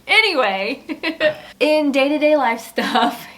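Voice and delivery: slow words broken by real laughter; laughing. Real, not acted. Wife changing the subject after a gross joke, laughing. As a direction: try to move on while laughing.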